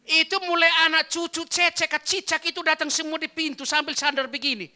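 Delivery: high, very loud narration; excited shouted storytelling; preaching storytelling. A narrator's reading. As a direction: tell it at full pulpit volume. Preacher tells it at full force.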